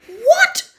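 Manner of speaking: shocked high yell. A narrator's reading. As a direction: yell it in disbelief. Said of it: "Harry yelled".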